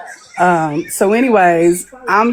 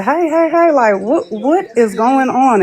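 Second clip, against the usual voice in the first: higher, calling out to stop a fight; mock alarmed hey hey hey; retelling breaking up a fight, raised voice; retold alarm; 6 semitones higher than usual. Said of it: "and I said".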